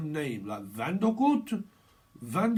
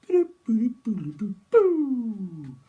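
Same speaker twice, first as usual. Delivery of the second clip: clock noise; 5 semitones higher, 6 dB louder than usual. Pretend. Imitating a countdown clock sound.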